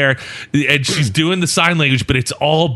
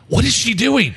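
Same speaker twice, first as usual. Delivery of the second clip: surprised; 7 semitones higher than usual. Retold or imitated. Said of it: "I'm like".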